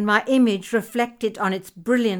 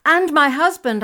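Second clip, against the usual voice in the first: high, loud eager cry; cried out; 7 semitones higher, 7 dB louder than usual. Acted. Wife calls out for her husband, a loud eager cry. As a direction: call out for him with sudden urgency. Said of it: "I cried out".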